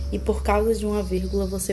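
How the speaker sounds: usual speaking voice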